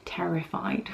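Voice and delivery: breathy whispered terrified. Retold or imitated. Vlogger retelling her fear, whispering terrified. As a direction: drop to a whisper on the word.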